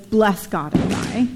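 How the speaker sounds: slow, sarcastic "Bless God and die"; wife mocking Job with sarcasm. Shown as a demonstration. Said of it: "catch the sarcasm".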